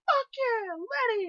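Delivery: high, loud cheer; imitated excited yell. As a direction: yell it back at the fan. She cheers back to the fan.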